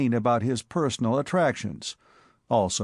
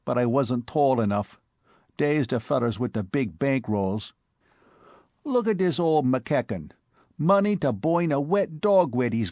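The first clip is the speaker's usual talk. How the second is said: higher, brash New York street voice; Bowery boy Spike; 3 semitones higher than usual. Acted. Spike breathlessly telling his boss about the cop, in a higher brash Bowery voice. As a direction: play the excitable Bowery tough.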